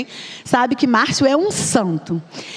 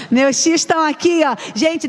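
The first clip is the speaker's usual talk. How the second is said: ordinary delivery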